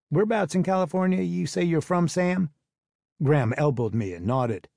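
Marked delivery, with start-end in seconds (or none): 0.1-2.5 put-on Southern accent. Southern man questions the newcomer.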